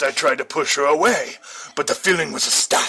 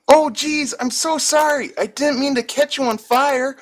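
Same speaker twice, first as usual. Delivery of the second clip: put-on high falsetto girl, apologetic; sorry girl; 14 semitones higher than usual. A narrator's reading. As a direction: fret like a flustered young woman. Apologetic girl voiced in story.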